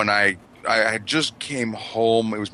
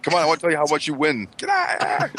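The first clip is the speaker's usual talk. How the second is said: mock angry yell; 8 semitones higher than usual. Acting annoyed at fireworks.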